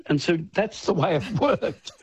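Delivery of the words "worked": usual speaking voice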